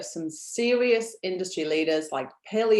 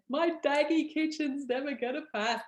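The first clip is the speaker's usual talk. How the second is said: worried customer; 7 semitones higher, 4 dB quieter than usual. Retold or imitated. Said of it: "sent me pictures of their kitchen saying".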